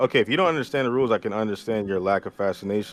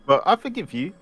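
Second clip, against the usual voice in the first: put-on British accent; 8 semitones higher than usual. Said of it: "Why does he sound British?".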